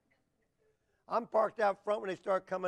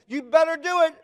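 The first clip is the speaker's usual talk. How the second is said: loud, high fatherly order; re-enacted dad shouting him down; retold angry dare; 10 semitones higher, 13 dB louder than usual. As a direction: bark it at the son as a challenge. Father daring his son.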